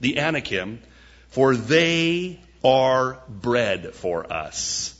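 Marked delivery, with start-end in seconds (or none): 1.3-4.9 slow, deliberate, every word weighted; slow emphatic. Shown as a demonstration. Preacher to congregation, slow and weighty. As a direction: lay it down slowly so it sinks in.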